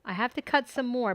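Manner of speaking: usual speaking voice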